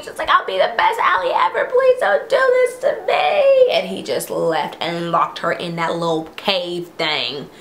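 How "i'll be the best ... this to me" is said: high, crying plea; mock desperate crying plea; pleading scream. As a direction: beg desperately through tears. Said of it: "she was screaming".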